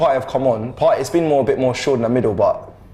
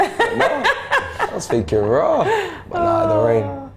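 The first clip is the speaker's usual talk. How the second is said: high, slow, laughing; 14 semitones higher, much slower than usual. Real, not acted. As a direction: laugh through the words. Laughing at his own mistake.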